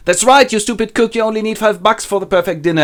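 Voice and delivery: high, loud, quick put-down; scolding servant. Acted. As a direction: pile on with a rapid scolding. Servant sides with miser, scolds.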